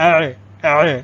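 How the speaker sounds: اے squeezed in the throat, drawn out. Shown as a demonstration. Teacher corrects the vowel-like version, throaty constricted اے. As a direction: push اے out from the throat. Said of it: "حلق سے ہی نکلتا ہے".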